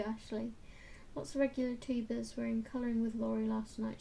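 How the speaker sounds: ordinary delivery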